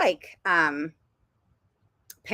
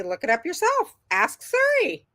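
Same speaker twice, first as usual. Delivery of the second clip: higher, sing-out parental nudge; imitated urging; 10 semitones higher than usual. Mom sending daughter off to ask Siri, high-pitched urging. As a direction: push the child to do it alone. Voices mom's reply to daughter.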